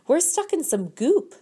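put-on high, urgent yell of a stuck kid. A narrator's reading. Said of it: "Ralphie yelled".

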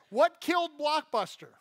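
normal talking voice

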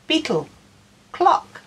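ordinary delivery

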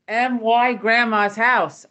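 "M Y" spelled letter by letter, slow and loud; spelled-out emphasis. Retold or imitated. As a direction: spell it out to own the house. Spelling out ownership, threatening ghost.

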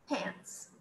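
whispered Pants. Shown as a demonstration. Teacher whispers Pants to the class. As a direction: breathe Pants softly.

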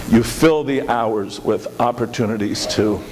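ordinary talk, nothing marked

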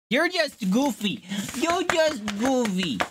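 high, slow doting pet voice. Real, not acted. Owner teasing a goofy pet, high slow baby talk.

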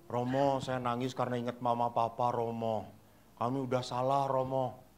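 low, soft confessing voice; imitated little girl. Girl admits her fault to the priest, soft imitated confession. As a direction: confess quietly as a remorseful girl. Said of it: "saya tanya anak perempuan".

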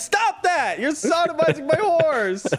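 high outraged shout; yelling at his friend to stop. Real, not acted. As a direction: cry out in shocked outrage. Outraged at horse abuse.

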